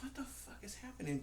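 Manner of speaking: high, hushed disbelief; breathy, soft retold bewilderment; bewildered whisper. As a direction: whisper it like an alarmed aside.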